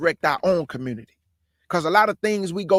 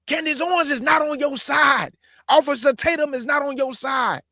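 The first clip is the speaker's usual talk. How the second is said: raised, higher call-out with NOT stressed; forceful warning; 6 semitones higher than usual. Real, not acted. Warning his family about Candace Owens, a raised preaching shout.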